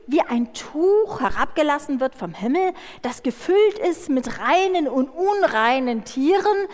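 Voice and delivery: ordinary talk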